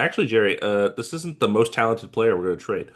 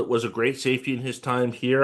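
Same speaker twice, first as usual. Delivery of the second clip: ordinary talk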